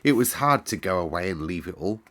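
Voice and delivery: ordinary delivery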